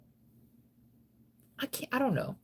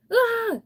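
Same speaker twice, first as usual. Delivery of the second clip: sudden cry; 7 dB louder than usual. Real, not acted. Sudden outburst.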